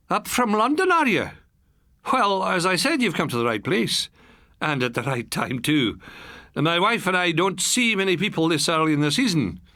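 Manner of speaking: a friendly Highland farmer, lightly voiced; light Highland accent. A narrator's reading. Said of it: "traces of the pure Highland accent".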